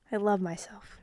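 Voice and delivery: whispered playful self-love; mock smug whisper. Real, not acted. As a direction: hush it, teasingly vain.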